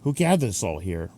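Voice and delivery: ordinary talk, nothing marked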